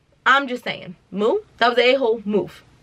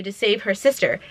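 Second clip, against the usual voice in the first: ordinary delivery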